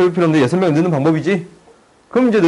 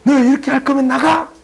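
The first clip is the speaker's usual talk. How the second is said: much higher mock angry mother ordering him out; angry mom; 12 semitones higher, 3 dB louder than usual. Tutor retelling the night his angry mom told him to leave, high mock anger. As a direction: throw the line like a fed-up mom. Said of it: "엄마가 화가 나셨어".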